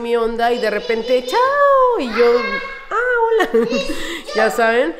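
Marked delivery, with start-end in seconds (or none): 1.3-2.0 much higher, chirpy mum's chao; sing-song chao. Retold or imitated. Mums greeting her at school. 2.9-3.5 surprised hello. Retold or imitated. Her own startled reply. 3.5-4.3 short real laugh at herself; amused laugh. Real, not acted. Laughs at the memory.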